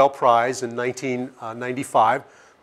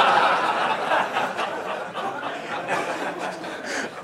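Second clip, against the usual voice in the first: laugh; 10 semitones higher, 8 dB quieter than usual. Real, not acted. Laughing at own joke.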